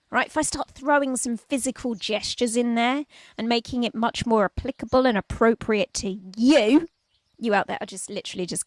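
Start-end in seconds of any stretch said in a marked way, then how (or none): none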